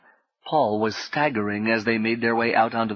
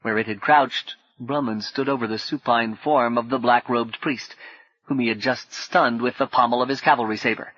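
ordinary delivery, nothing marked

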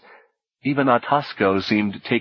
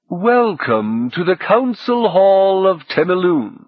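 grand host's greeting, slow and high; high, slow ceremonial welcome; formal welcome; 9 semitones higher, 4 dB louder, slower than usual. Acted. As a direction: proclaim it to the guests, drawn out. Host formally welcomes assembled guests.

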